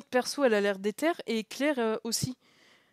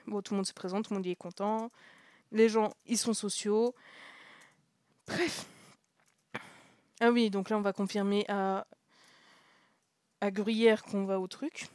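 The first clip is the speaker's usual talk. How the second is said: normal talking voice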